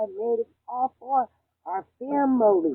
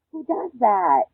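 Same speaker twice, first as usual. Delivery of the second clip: outraged; 2 semitones higher, 10 dB louder, slower than usual. Real, not acted. Outraged rant about partner.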